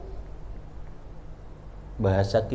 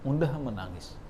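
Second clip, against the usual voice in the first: softer repeat of "Mudah menangis", falling; soft echo; 7 dB quieter than usual. Shown as a demonstration. Preacher repeating that Umar cries easily, soft echo. Preacher stresses Umar's softness.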